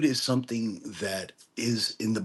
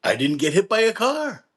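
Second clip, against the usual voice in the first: punchline voiced higher than usual; teacher's punchline, higher; 10 semitones higher, 3 dB louder than usual. Kabbalah teacher answering the student, higher punchline. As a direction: land the teacher's dry punchline. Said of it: "He says".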